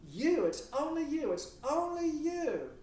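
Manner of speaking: gushing lover. Pretend. Honey Bunny swears undying love to their partner, high gushing voice. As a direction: lay it on thick, squeaky and too eager to convince. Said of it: "And Honey Bunny says".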